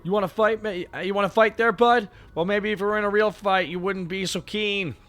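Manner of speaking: high, loud Canadian tough-guy challenge; a hockey-rink Canadian squaring up; strong Canadian accent. Acted. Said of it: "very strong Canadian".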